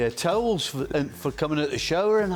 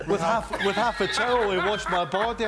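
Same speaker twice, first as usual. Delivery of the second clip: ordinary talk, nothing marked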